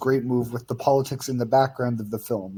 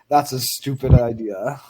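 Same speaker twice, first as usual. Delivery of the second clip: slow, blunt imitation of a war-torn country accent; foreign accent; 4 dB louder, slower than usual. Student retells professor's blunt reply in her war-torn country accent. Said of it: "in her war-torn country accent".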